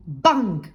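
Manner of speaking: loud imitated door slam; slamming-door bang. A narrator's reading. People slamming doors in a noisy land, loud bang. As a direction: slam the word out hard. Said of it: "Ils les claquaient".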